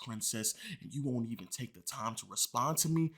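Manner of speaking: low, soft menacing voice; hammed-up quiet menace; mock menacing. Reading fan's threatening text.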